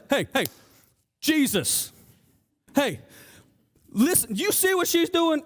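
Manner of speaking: mock-aggressive bid for attention, rising at the end; brusque, demanding interruption. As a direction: butt in with short, separated calls. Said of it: "comes in an aggressive manner".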